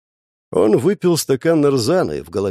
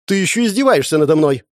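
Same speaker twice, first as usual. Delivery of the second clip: angry accusation; 5 semitones higher, 4 dB louder than usual. Acted. Wife accuses husband.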